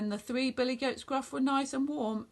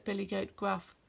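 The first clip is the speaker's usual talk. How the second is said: ordinary delivery, nothing marked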